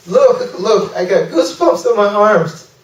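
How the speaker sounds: high-pitched, thrilled quote of the friend; excited lady boy. Retold or imitated. Lady boy grabbing the visitor about the king, excited. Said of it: "the lady boy grabs me, and he goes".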